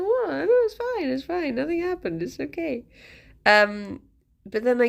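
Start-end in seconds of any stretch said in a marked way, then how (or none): 0.0-2.8 squeaky high innocent dog voice; voicing the dog. Voicing Fifi insisting nothing happened, squeaky and high. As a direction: play the pet denying everything, high. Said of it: "She's like".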